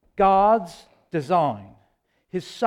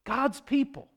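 higher-pitched, still soft exclamation; incredulous repeat; 4 semitones higher, 6 dB quieter than usual. Real, not acted. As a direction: lift the repeat high in disbelief. Repeats the phrase in disbelief.

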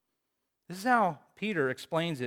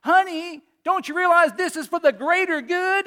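pleading husband; 14 semitones higher, 10 dB louder than usual. Retold or imitated. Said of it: "Frozone pleading with his wife".

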